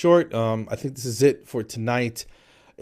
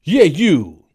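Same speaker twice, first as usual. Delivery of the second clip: loud, raised mock shout; man calling back; 7 semitones higher, 10 dB louder than usual. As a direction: holler back insistently as the song's man. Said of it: "You hear the guy again".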